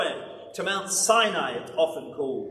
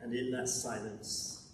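much softer and lower, reverent hush; hushed awe; 7 semitones lower, 9 dB quieter than usual. Acted. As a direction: let it fall to a near-silent reverence. Describing the still small voice.

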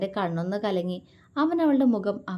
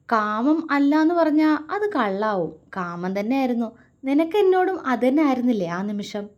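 roguish, a touch louder; sly grin; 4 semitones higher, 5 dB louder than usual. Acted. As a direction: tease her with a sly smile. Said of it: "അവൻ കള്ളച്ചിരിയോടെ ചോദിച്ചു".

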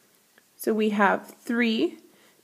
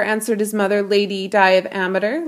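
usual speaking voice

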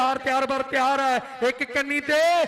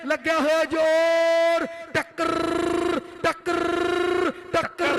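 higher, slow build on each call; rising excited commentary; 5 semitones higher, slower than usual. Real, not acted. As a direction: call every clash higher. Raising pitch on tense contest.

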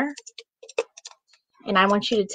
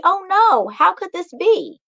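dismayed cry; 9 semitones higher than usual. A narrator's reading. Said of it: "cried Macy".

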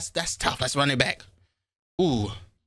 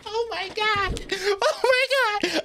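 very high, drawn-out oh my God; stunned squeal; much slower than usual. Real, not acted. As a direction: gasp it out, way up and slow. Stunned by the lyric.

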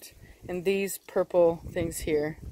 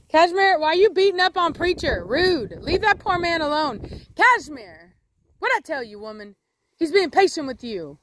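scolding the dog; 5 semitones higher than usual. Real, not acted. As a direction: tell the dog off sharply.

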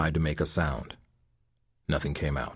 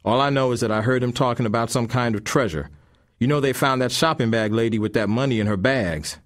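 higher, louder street voice; scheming thug; 5 semitones higher, 5 dB louder than usual. A narrator's reading. Thug explaining the rumored treasure, higher and louder. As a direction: sell the rumor to your partner.

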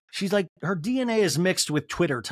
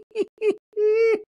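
high squealing laugh; high loud burst of laughter; 5 dB louder than usual. Real, not acted. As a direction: shriek of laughter, much higher and louder.

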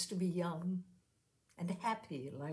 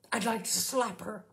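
with venom; 4 semitones higher, 5 dB louder than usual. A narrator's reading. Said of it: "to add with venom".

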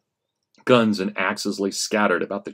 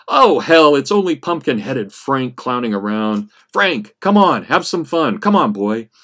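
put-on relieved drunk; 4 semitones higher, 6 dB louder than usual. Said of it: "said in relief with a coughing laugh".

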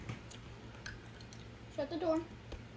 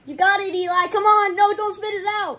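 loud, eager encouragement; excited cheering; 5 semitones higher, 5 dB louder than usual. Real, not acted. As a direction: cheer him on loudly. Cheering brother on.

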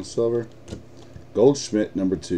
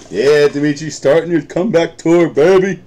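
higher and louder, hyping a viewer up; excited cheer; 6 semitones higher, 7 dB louder than usual. Real, not acted. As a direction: cheer him on like a hype man.

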